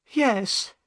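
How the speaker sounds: high-pitched upset woman; Missus Smith, upset. A narrator's reading.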